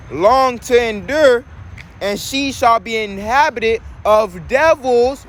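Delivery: scripture reading. Real, not acted. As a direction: proclaim the verse slowly, stretching the words. Reads scripture aloud.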